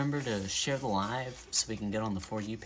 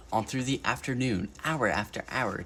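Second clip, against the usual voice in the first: plain reading voice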